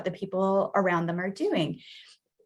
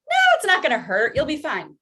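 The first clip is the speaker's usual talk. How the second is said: fast, higher, dismissive reassurance; brushing-off adult; 7 semitones higher, 6 dB louder, faster than usual. Retold or imitated. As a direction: wave the fear away quickly. Adult dismisses child's fear.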